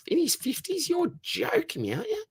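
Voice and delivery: mock-shocked, voice shooting sky-high; high-pitched you're-kidding-me surprise; squeaky disbelief. Real, not acted. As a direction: jump way up into a high, incredulous squeal. Amazed the donor is in his fifties.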